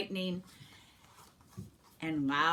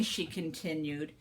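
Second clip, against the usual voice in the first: ordinary delivery, nothing marked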